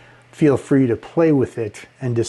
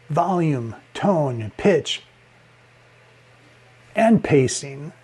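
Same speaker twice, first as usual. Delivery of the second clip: each word set apart by pauses; pregnant pauses; slower than usual. Shown as a demonstration. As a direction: pause dramatically between volume, tone, pitch. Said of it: "put a pregnant pause in there".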